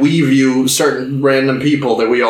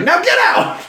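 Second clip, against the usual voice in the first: mock freak-out. Singer throwing her guests out, mock freak-out. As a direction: squeal it like an imitated meltdown. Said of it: "starts freaking out".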